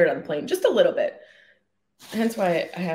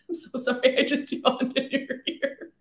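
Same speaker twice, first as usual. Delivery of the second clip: laughing; 7 semitones higher than usual. Real, not acted. Laughing after a yawn.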